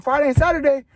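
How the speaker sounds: high, loud surprised question; grandma asks back in surprise. Retold or imitated. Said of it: "She was like".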